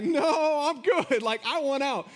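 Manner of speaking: loud, high, drawn-out plea to get out; hammed-up alarm, pitched well up; mock panicked refusal. Imagined person trapped with lion.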